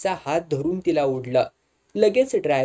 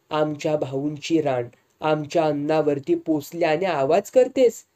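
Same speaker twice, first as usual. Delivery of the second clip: ordinary delivery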